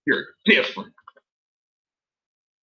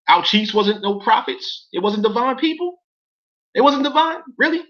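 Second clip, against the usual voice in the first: mock disbelief; 8 semitones higher than usual. Real, not acted. Mocking the document's claim.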